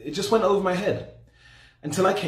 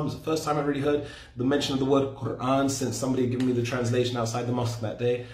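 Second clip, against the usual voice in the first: ordinary delivery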